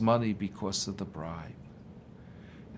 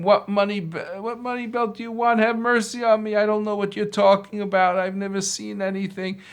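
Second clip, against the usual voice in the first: desperate pleading; 9 semitones higher, faster than usual. Acted. Beaten man begging for mercy.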